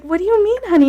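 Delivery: very high-pitched, baffled question; retold dumbfounded plea. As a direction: act out utter bewilderment at a spouse. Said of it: "I was dumbfounded".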